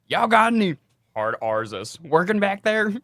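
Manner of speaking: loud, slow, high mock yokel voice, full chest; brazen customer. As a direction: lean in and say it with your full chest.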